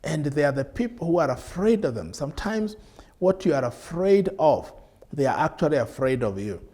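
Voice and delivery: usual speaking voice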